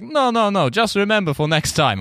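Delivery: high, breezy mock Russian bouncer; Russian accent, lightly mocking. Bouncer quoted.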